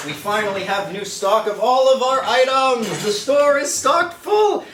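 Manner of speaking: high, drawn-out salesy excitement; hyped announcement. Pretend. Host plugging restocked merch to viewers, over-the-top hype.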